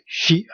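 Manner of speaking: "she" as an isolated syllable; clear, separate "she"; demonstrated sound. Shown as a demonstration. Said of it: "sounds like a S H I".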